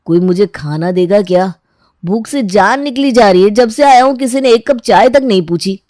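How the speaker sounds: loud, aggrieved complaint; put-out, forceful griping; hungry grumbling. Acted. Hungry man complaining to household.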